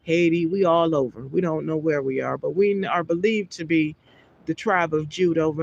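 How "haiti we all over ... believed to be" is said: ordinary talk, nothing marked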